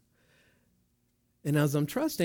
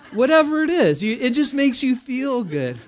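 higher, louder, laughing through the words; 5 semitones higher, 6 dB louder than usual. Real, not acted. Pastor jokes about being given money, laughing. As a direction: crack up at your own joke.

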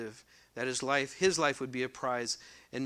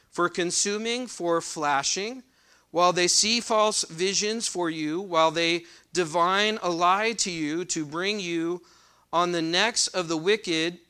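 raised, louder reading voice from the pulpit; scripture reading; 3 semitones higher, 6 dB louder than usual. Acted. Preacher reading Ezekiel aloud to the congregation, proclaiming. Reads next verses aloud.

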